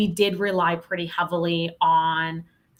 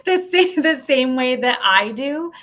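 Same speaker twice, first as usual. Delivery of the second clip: laughing; 6 semitones higher, 5 dB louder than usual. Real, not acted.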